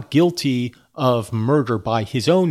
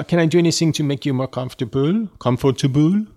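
quicker, hammed-up French accent; 4 semitones higher than usual. Kerwin offers the prisoner comfort, playful French accent. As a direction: lean into a put-on French accent. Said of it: "I am reading it with a French accent".

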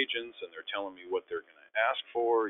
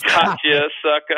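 mock taunt; 8 semitones higher, 12 dB louder, slower than usual. Teammate reveals the prank.

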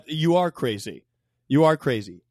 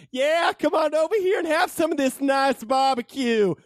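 mock shouted invitation; 7 semitones higher than usual. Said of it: "and you went".

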